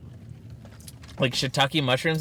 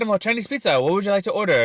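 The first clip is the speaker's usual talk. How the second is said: mock Chinese accent; 6 semitones higher, faster than usual. Teen prank caller taking an order, mock Chinese accent. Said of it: "and I'd be like".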